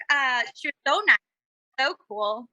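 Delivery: usual speaking voice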